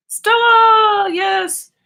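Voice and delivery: high shout to the dog; calling the dog. Real, not acted. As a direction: call her name out high.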